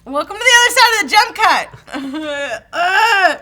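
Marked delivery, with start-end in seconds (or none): none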